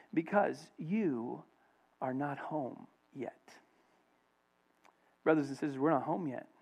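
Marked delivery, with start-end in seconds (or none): 0.1-3.6 very slow, soft and measured; hushed divine answer. Retold or imitated. The Lord answering the missionary in his ear, hushed. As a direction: let it fade gently, word by word. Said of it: "the Lord whispers in his ear".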